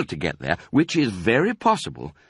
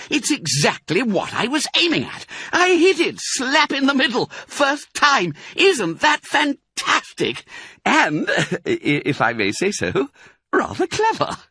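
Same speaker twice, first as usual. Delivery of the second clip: proud fox. A narrator's reading. Mister Fox to his cubs, high proud boasting. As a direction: crow about your own cleverness.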